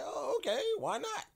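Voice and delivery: high, innocent put-on voice; faux-innocent squeak; mock naive. Acting naive to a stranger.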